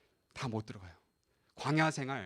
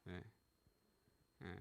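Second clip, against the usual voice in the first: ordinary delivery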